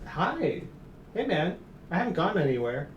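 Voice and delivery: high, soft cooing at the cat; pet talk. Real, not acted. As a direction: coax the cat over gently.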